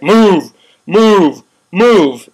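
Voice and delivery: loud barked command, each move hit hard; mock police order. Mocking riot police ordering protesters out, loud barked commands. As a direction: bark it like a cop clearing the park. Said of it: "inappropriate just to be like".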